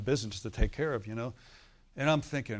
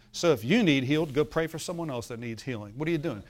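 ordinary talk, nothing marked

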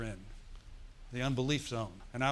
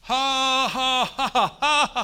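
loud, high deliberate ha ha ha; shouted ha ha laughs, much higher and louder; forced laughter by faith; 11 semitones higher, 12 dB louder, slower than usual. Shown as a demonstration. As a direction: laugh out loud on purpose at the problem. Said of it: "No different".

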